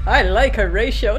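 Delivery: ordinary delivery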